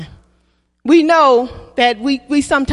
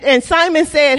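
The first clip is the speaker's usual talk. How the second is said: usual speaking voice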